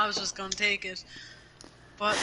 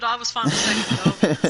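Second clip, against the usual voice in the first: louder, laughing through it; 9 dB louder than usual. Real, not acted. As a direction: laugh while saying it. Laughing at his own remark.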